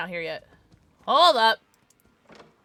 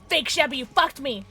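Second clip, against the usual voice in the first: slightly raised, aggrieved cry; betrayed complaint; 2 semitones higher than usual. Real, not acted. Player blaming Fake Shabby for the hit, aggrieved complaint. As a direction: say the name accusingly, well short of screaming. Same name again, after the scream.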